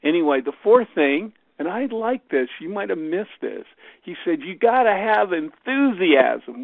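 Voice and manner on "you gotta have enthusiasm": put-on lightly Southern accent, slow and emphatic. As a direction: imitate a Southern speaker, louder and higher. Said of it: "with his Southern accent".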